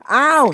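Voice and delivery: loud, high "ow"; pained yelp. A narrator's reading. Percy is hit very hard, pained yelp. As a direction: yelp on impact.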